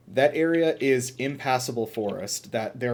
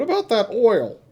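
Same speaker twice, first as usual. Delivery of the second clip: put-on high, loud old prospector voice; 10 semitones higher, 6 dB louder than usual. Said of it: "say it like an old prospector".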